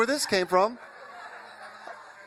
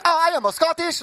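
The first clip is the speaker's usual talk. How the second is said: high mock Scottish sliding into Hong Kong; botched Scottish accent; 10 semitones higher, 4 dB louder than usual. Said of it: "ends up in a Hong Kong".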